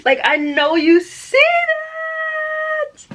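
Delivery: high, slow, loud squeal; excited shriek. Real, not acted. Showing the book cover to camera, excited squeal. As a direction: squeal it out in delight.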